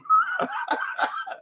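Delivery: sudden high burst of laughter. Real, not acted. Laughs at perishing joke.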